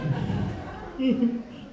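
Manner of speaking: short, soft chuckle; laugh. Real, not acted. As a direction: chuckle quietly to yourself. Speaker laughs after own joke.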